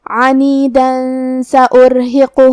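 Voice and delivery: عنیدا flowing into سأرہقہ with the tanween hidden and nasalized; nasal ikhfa demo. Shown as a demonstration. Teacher demonstrating ikhfa to students, nasal joining. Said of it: "یہ تنوین کو چھپاتے ہوئے لیکن غنہ کے ساتھ".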